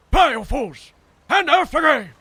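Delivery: high, very loud mock wizard proclamation; a streamer imagining Harold as a grand wizard, shouting; Gandalf-style shout. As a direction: bellow it like a hammed-up Gandalf. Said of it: "Harold's gonna talk like Gandalf the Grey".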